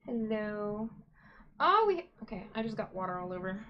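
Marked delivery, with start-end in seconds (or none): 1.6-2.1 startled. Real, not acted. Spilled water.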